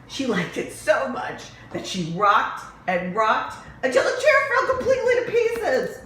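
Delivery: reading through rising laughter; laughing. A narrator's reading. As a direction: giggle as the chair breaks apart. Laughing at chair breaking.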